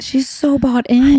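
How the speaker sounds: slow, high and emphatic. Real, not acted. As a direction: lean hard into it. Emphatic agreement.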